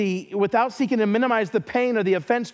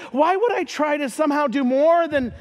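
ordinary talk

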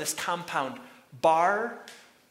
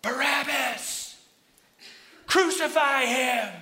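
slow, high, drawn-out roar; crowd yelling; 10 semitones higher, 7 dB louder, slower than usual. Acted. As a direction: shout it like a mob calling for blood. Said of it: "They start yelling back again".

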